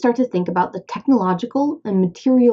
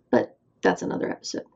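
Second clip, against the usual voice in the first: whispered aside; 7 dB quieter, faster than usual. Real, not acted. Throwaway aside.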